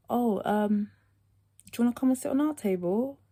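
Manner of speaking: overly sweet high invitation; high bright invite. Retold or imitated. Inviting the boy to sit, in a sweet high voice. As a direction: ask it in a polite high voice.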